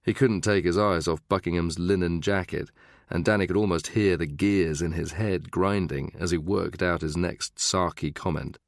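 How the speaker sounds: ordinary delivery, nothing marked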